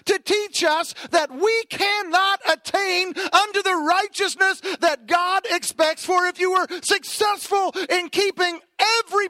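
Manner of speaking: loud, high-pitched preaching at full force; fiery preaching. Acted. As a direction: drive the law home to the congregation.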